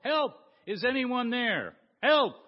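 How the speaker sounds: high, loud, desperate cries for help; yelling for help. Acted. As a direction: shout it up from the cliff edge. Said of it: "And he yelled".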